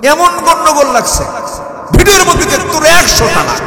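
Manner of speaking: imitated furious shout, very high and loud; loud, high mock tirade; angry husband's yell. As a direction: act out a husband exploding on a call. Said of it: "রাগের ঠেলায় কইছে".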